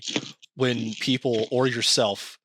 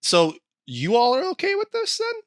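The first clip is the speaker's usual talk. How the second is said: high, fast disbelieving questions; incredulous. Real, not acted. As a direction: demand an answer in outrage. Indignant rhetorical questions.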